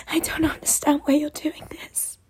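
tearful whisper; hushed, bewildered plea. Acted. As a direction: whisper it in hurt confusion. Pleading roleplay, sudden whisper.

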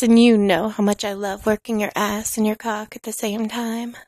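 ordinary delivery

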